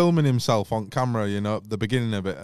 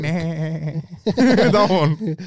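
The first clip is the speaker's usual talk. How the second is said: Peter Griffin voice; 4 semitones higher than usual. Retold or imitated. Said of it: "Do Peter Griffin".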